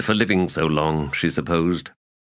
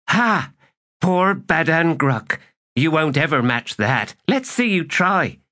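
gloating taunt; 10 semitones higher than usual. Acted. Kisware taunts a rival over a dice throw, gloating. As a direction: crow over a rival's poor chances.

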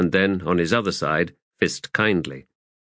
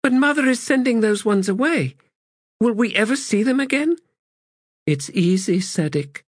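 put-on high, light child voice; young girl's voice; 13 semitones higher than usual. Child asking her mother's daughter about the family, high and young. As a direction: speak as a small wondering girl.

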